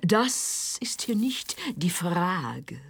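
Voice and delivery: slow, whispered tragic recital; mock-solemn stage whisper; hammed-up Shakespeare. Parodying Hamlet's soliloquy.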